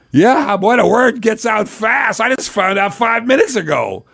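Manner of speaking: high-pitched, drawn-out mock astonishment; amazed retort. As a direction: exclaim it way up high, stretching the words. His startled reply on the phone, retold.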